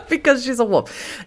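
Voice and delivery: said through laughter; laughing. Real, not acted. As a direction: chuckle through it.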